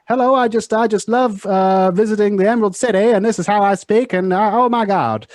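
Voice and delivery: put-on high, old-Hollywood transatlantic accent; hammed-up transatlantic accent, pitched well up. Shown as a demonstration. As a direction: gush like a transatlantic movie starlet of old films. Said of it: "that kind of transatlantic accent".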